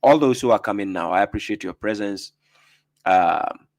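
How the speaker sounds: ordinary talk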